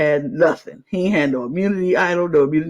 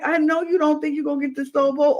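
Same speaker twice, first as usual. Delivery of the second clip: fast, higher exasperated voice; exasperated aside; 8 semitones higher, faster than usual. Retold or imitated. Retelling her reaction to the player, fast exasperated. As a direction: rush it, fed up with him. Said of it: "So I'm like".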